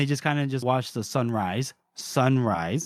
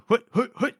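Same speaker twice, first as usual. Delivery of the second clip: owl hoot; 7 semitones higher than usual. Retold or imitated. Mimicking an owl signal.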